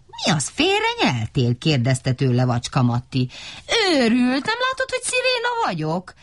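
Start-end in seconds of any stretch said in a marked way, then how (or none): 0.1-1.6 light, high-pitched query; lightly voiced high little fellow; high questioning voice. A narrator's reading. Teasing question to the siren. 3.9-6.1 very high, squawky protest; high-pitched offended siren; indignant crow character. A narrator's reading. Crow indignant at being misunderstood.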